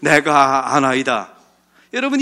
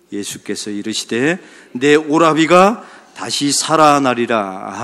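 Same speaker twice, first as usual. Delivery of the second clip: scripture reading; 3 semitones lower, 4 dB louder, slower than usual. Acted. Congregation reads scripture together.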